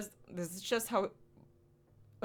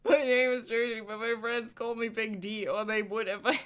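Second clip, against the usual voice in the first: goofy boastful voice; 3 semitones higher, 4 dB louder than usual. Acted. Silly big voice.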